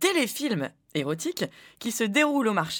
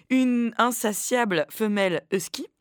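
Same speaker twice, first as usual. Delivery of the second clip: ordinary talk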